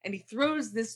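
ordinary talk

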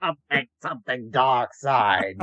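slow, drawn-out villain parody; mock dark-side villain. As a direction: drag the words out like a hammed-up Sith. Parodying a Star Wars villain.

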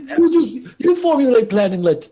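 high, loud henchman voice; Krakow. Acted. Henchman asking the boss for a plan, high put-on voice. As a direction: push it up high, talking about himself by name. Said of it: "Krakow know".

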